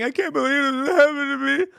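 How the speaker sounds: high, hammed-up blubbering; mock crying. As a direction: whine it like a crybaby in tears. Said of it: "And he starts crying".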